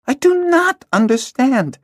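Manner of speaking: put-on high, slow girl's voice crying out in exasperation; a girl cries out to a mute friend in exasperation; exasperated cry. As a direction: plead with frustration as a young girl. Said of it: "Hala cried to Peller in exasperation".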